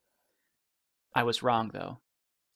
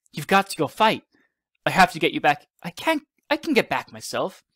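high, louder insistence; urgent pleading; 6 semitones higher, 5 dB louder than usual. A narrator's reading. Child urging father to leave and fight, insistent. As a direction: push back eagerly, pitch jumping up.

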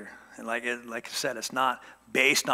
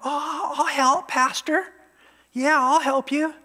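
much higher, louder, sugary-sweet helper voice; mock eager volunteer; 13 semitones higher, 6 dB louder, slower than usual. Pastor mocking a volunteer who later complains, high fawning offer. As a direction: gush your offer like an overkeen churchgoer.

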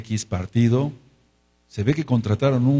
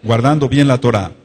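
louder, spelled-out vow for the church to repeat; loud call and response; 7 dB louder than usual. Shown as a demonstration. Preacher leading the congregation's vow, loud and emphatic. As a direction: lead the room in a loud vow. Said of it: "Repite conmigo".

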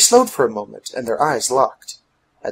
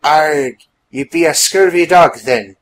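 loud pirate growl-along, pitched up; pirate talk; 3 semitones higher, 7 dB louder than usual. A narrator's reading. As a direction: talk like a hearty pirate, loudly. Woman answers in pirate talk.

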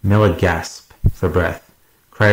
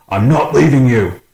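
raised, louder refusal; loud, higher-pitched determined shout; defiant yell; 6 semitones higher, 5 dB louder than usual. A narrator's reading. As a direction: yell it back over the chaos. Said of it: "Miller yelled".

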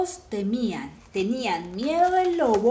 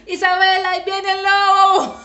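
high, loud mock warning; mock-panicked yell, laughter behind it; playful alarm shout; 10 semitones higher, 11 dB louder than usual. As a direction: tease a child with a yelled wolf alert. Teases child with wolf alarm.

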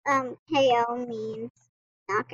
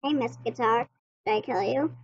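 ordinary talk, nothing marked

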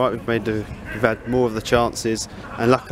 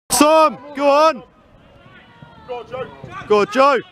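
yelling from the sidelines; 12 semitones higher than usual. Real, not acted. Fan shouting at football match.

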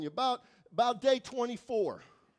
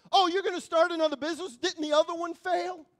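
quick, higher sarcastic jab; mocking sneer; 7 semitones higher, 6 dB louder than usual. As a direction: sneer at their new dream. Mocking voices of the past.

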